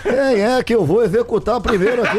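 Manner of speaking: halting politician caricature; Bolsonaro. Retold or imitated. Guest shows how the politician was in the video, caricature voice. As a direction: mimic the politician stumbling into his line. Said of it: "o Bolsonaro, Batista, ele tá assim".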